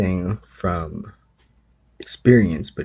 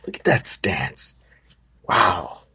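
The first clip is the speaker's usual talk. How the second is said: mocking aside; 4 dB louder, slower than usual. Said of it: "Starts mocking him".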